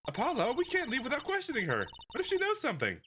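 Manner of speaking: put-on high, bright-eyed girl voice; perky girl sidekick. Young assistant urging her partner out, high and eager. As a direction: chirp it like an eager young magician.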